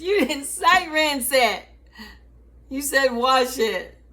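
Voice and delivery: protest, half-laughing, that she followed orders; mock indignant retort. Answering mom back, mock indignant and laughing.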